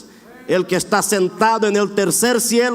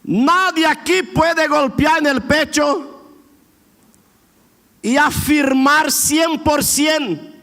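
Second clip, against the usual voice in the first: rising, emphatic sermon delivery, higher and stretched; preaching; 5 semitones higher than usual. Acted. Pastor urging the congregation to praise now, fervent preaching. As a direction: build the appeal, pressing each phrase. Preaching builds to full force.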